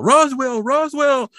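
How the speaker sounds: very high imitated protest chant; mock protest cry. Blaine restrained at the autopsy, crying out in protest. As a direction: call it out like a hammed-up protester.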